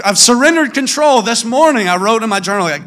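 usual speaking voice

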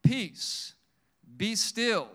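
ringing authoritative order; commanding rebuke. Acted. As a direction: rebuke the wind, firm and loud, with a pause between phrases. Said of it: "rebuked the wind and said to the sea".

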